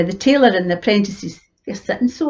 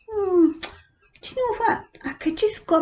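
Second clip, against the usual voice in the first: musing miller; 11 semitones higher, 6 dB quieter than usual. Acted. Miller thinking to himself.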